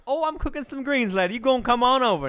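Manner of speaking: high, loud bubbly auntie. Retold or imitated. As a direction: call out like a welcoming auntie. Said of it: "she's like".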